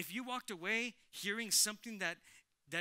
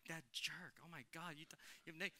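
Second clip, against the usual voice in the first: much softer, huffy under-the-breath grumble; offended muttering; 8 dB quieter than usual. Retold or imitated. Churchgoer cutting off the honest friend, quiet huffy grumble.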